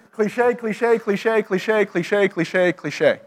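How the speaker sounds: singsong run, starting high and stepping down; mocking repetition. Shown as a demonstration. Speaker mocking church jargon, descending repetitive patter. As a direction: reel it off as one descending string.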